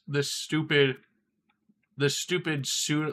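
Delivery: ordinary talk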